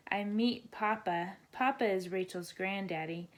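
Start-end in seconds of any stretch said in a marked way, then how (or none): none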